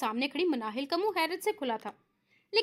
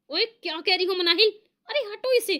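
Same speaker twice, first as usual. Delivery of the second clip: flustered; 5 semitones higher, 6 dB louder than usual. Acted. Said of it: "हड़बड़ाकर".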